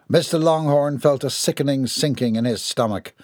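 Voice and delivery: usual speaking voice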